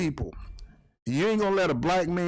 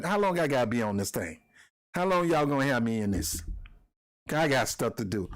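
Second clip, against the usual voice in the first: lower, lazy mock of the suspect; 5 semitones lower than usual. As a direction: drag it out, bored and careless. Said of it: "act like it ain't nothing".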